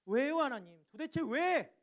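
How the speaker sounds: loud, rising cry of lament; impassioned plea, pitched high; anguished outcry. Acted. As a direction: cry out to God, demanding why. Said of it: "탄식이 담긴 부르짖음이에요".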